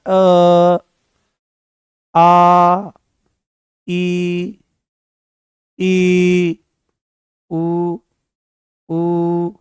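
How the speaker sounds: each vowel held long and steady; teacher leads students through vowels slowly; drawn-out vowels. Shown as a demonstration. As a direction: sustain every vowel so the throat vibrates. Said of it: "केवल अ बोलना है".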